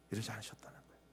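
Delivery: softly, almost to himself; hushed repeat. Shown as a demonstration. Preacher repeating softly to the congregation, hushed. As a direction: let the repeat fall to a hush.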